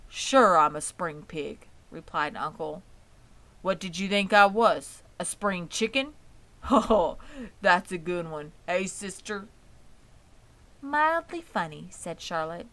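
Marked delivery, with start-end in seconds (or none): 0.1-1.7 slow and louder, a bluff pig; big, hearty voice. A narrator's reading. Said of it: "big, hearty voice". 3.6-9.5 louder, laughing at his own joke; hearty joking pig. A narrator's reading. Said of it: "big, hearty voice". 10.8-11.9 higher, politely cool; dry, unimpressed. A narrator's reading. Charlotte unimpressed.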